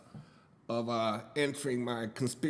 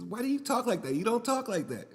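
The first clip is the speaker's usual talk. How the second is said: higher mock voice of the wife, incredulous; wife questioning his slang, higher mock voice; imitating wife; 9 semitones higher than usual. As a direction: play the wife calling him out, lightly pitched up. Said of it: "And she says".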